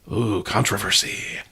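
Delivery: breathy teasing whisper; mock whisper. Real, not acted. Podcast host whispering a teasing aside. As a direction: hush it like a juicy secret.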